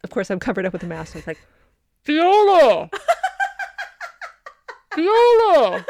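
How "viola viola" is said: high, loud call across the room. Retold or imitated. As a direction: call it out loud, not yelling. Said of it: "loud voice, not yelling".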